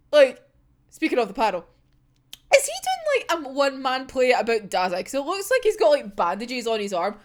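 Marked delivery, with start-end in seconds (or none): none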